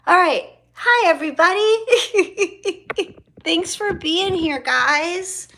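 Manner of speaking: high, loud, laughing welcome; bright excited welcome. Real, not acted. Welcoming viewers to the live stream, high and laughing.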